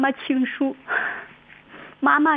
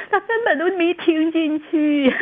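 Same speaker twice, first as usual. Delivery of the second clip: crying; 4 semitones higher than usual. Real, not acted. Daughter recalling her dying mother, crying as she speaks. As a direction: push through the sob.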